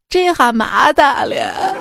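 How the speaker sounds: slow, drawn-out Xi'an dialect. Shown as a demonstration. Host translating "in trouble" from English, drawn-out Xi'an dialect. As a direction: exclaim it like a Xi'an local. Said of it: "当英语遇到西安话".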